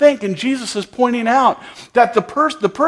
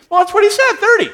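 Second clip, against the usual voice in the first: much higher, mildly baffled protest; flustered protest; 11 semitones higher, faster than usual. Retold or imitated. Preacher retelling his baffled reply to the tourists, higher. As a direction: protest in a higher voice, a little helpless.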